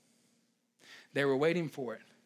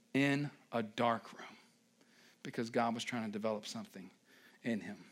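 hushed; 6 semitones lower, 8 dB quieter than usual. Real, not acted. Dramatic hushed preaching.

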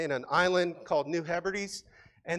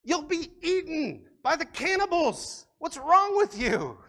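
higher, louder alarmed cry, acted out; a mock panicked friend, pitched up; alarmed warning; 9 semitones higher, 5 dB louder than usual. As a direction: warn him in horror that they'll eat him. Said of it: "one person said".

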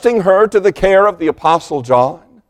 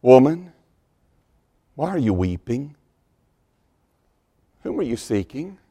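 much softer, hushed and gentle; tender comfort; 7 dB quieter than usual. Acted. Risen Jesus gently asks Mary why she weeps, soft and tender. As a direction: comfort a weeping woman in a near hush. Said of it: "Such a tremendous word of comfort".

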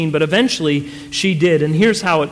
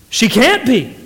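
higher, exclaimed denial; startled disbelief; 4 semitones higher than usual. A narrator's reading. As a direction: blurt it out, refusing to believe it. Husband refuses to believe she cries.

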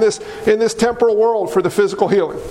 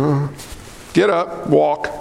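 unsure, trailing lower mumble, not meaning it; hesitant hypocrite; 10 semitones lower, slower than usual. Retold or imitated. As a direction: hesitate like a hypocrite who doubts. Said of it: "The hypocrite's like".

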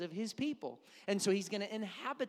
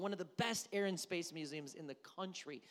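ordinary talk

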